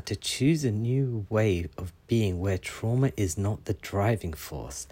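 slow, weighing each word; thoughtful slow rereading; slow deliberate reread. Shown as a demonstration. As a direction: read it again slowly to consider it. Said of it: "That is interesting because".

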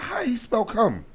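slow, high disbelieving ask; incredulous question. Real, not acted. Friend asking how the texter spelled it, slow and incredulous. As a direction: drag out the question in shock.

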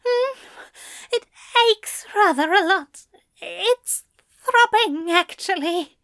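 high, breathy strained voice; breathless and strained. Acted. Aziraphale breathless on the phone.

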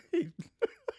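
sharp high cackle; high squealing laugh. Real, not acted. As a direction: burst into a shrill laugh. Laughs at own story.

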